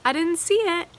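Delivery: a hammed-up "didn't see a thing"; mock denial. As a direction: play dumb like someone looking away. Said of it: "we put the blindfold on and say".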